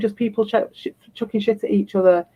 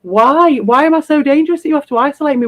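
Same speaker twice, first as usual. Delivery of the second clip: ordinary talk, nothing marked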